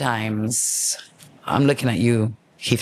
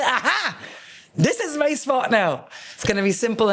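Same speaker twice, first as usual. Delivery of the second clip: triumphant aha; 8 semitones higher than usual. Retold or imitated. Triumphant at finding pub.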